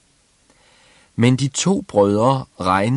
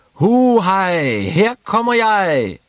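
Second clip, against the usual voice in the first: shouting gleefully; 11 semitones higher, 5 dB louder, slower than usual. A narrator's reading. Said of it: "råbte Klods Hans".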